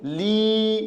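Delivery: 'ली' held on a long ee; long sustained ee in 'ली'; drawn-out vowel. Shown as a demonstration. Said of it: "खींच के बोलते हैं".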